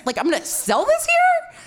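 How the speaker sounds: panicked disbelief. Retold or imitated. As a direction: panic rising to a squeak.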